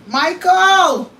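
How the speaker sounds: long, loud, high call of his full name; hollering Michael from the kitchen. Real, not acted. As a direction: stretch the name out to carry through the house. Calling husband from kitchen.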